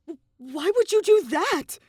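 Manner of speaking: put-on a stunned boy demanding an answer, loud and high; horrified demand. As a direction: recoil in horror as you ask. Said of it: "Shuichi demanded in horror".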